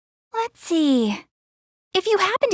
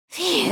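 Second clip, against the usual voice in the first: relieved sigh; 3 semitones higher, 5 dB quieter than usual. Acted. Relieved after begging for secrecy.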